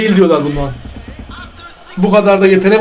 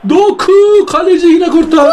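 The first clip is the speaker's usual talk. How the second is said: excited; 8 semitones higher than usual. Real, not acted. Watching a save in the game.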